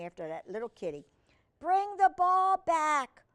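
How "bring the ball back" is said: high, loud, drawn-out command; calling back puppy. Acted. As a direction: call slowly across to a running dog. Girl calls to running puppy.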